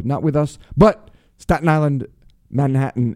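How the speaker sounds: normal talking voice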